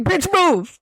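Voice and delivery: high-pitched mock order; hammed-up, high impatient yell; bossy dog voice. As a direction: shove someone aside with your voice, playfully. Voices dogs knocking man over.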